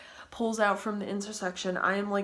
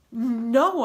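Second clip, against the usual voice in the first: louder, higher acted "no"; retold firm refusal; 4 semitones higher, 8 dB louder than usual. Girl refusing the truck driver, loud acted refusal.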